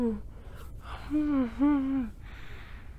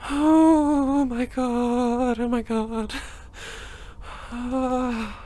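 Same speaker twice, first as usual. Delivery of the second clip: ordinary talk, nothing marked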